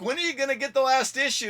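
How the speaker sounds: high, loud question shouted from the car; calling out. Retold or imitated. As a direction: holler it across the street. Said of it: "he's like".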